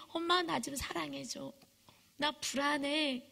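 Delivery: put-on high, soft childlike plea; anxious pleading child. Child afraid mom will leave, pleading softly. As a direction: beg gently in a small voice.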